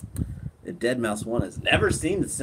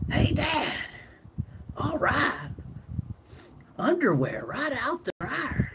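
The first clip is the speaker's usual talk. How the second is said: put-on high, thin voice of a young boy; Bobby Hill; 13 semitones higher, 4 dB quieter than usual. Streamer doing a cartoon kid for chat, high boy voice. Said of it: "it's Bobby Hill".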